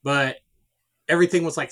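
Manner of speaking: ordinary talk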